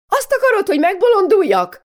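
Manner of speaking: mother losing it at her daughter, shrieking; exasperated shriek. Acted. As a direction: scream it at the end of her tether.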